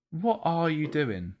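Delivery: normal talking voice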